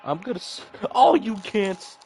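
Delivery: frustrated outburst. Real, not acted. Frustrated at the autosave.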